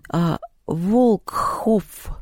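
slow, breathy, stretched Волкхоф; breathy drawn-out name. Retold or imitated. Поляндра calling Антон by surname, breathy drawl. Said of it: "как будто с придыханием".

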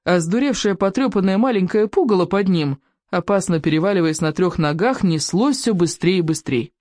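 ordinary delivery, nothing marked

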